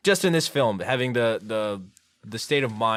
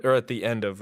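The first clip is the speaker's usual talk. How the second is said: usual speaking voice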